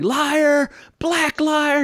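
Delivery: high squawking accusation, imitated; mock Strother Martin yell. Podcast host mimics the film character accusing his partner, high mock yell. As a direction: shriek it like a wounded old coot. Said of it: "And he's like".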